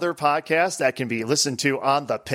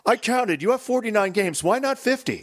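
lightly voiced nitpicky customer; 6 semitones higher than usual. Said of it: "Everyone comes in".

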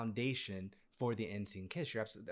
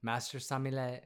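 ordinary talk

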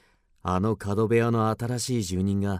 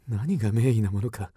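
normal talking voice

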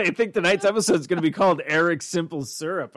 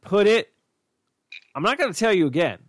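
nagging mom; 4 semitones higher than usual. Pretend.

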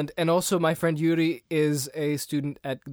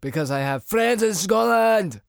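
slow, loud mock Scottish accent; a hammed-up Scottish accent, slowed and loud; 4 semitones higher, 4 dB louder, slower than usual. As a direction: shout it like a drunk Scotsman. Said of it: "he's turning into Randy".

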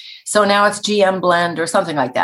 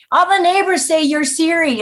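higher-pitched imitation of a child; little boy; 10 semitones higher than usual. Guest retelling a Halloween visitor's words, child voice. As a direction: lightly mimic a boy piping up. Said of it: "a little boy came to the front door".

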